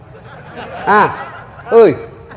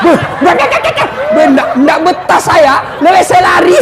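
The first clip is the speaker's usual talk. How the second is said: panicking jinn; 8 semitones higher than usual. Retold or imitated. The jinn fleeing the nagging wife, high and frantic. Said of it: "jin penunggu sumur".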